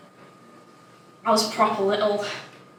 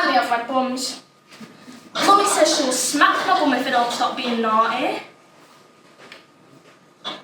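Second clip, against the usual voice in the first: ordinary delivery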